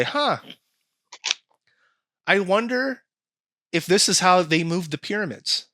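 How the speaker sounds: high, wondering voice; lightly voiced curious commenter; musing commenter. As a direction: pose it as an idle wondering question. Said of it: "There'll be someone in the comments".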